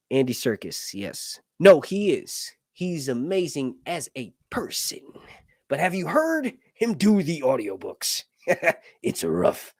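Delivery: usual speaking voice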